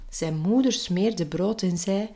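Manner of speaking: plain reading voice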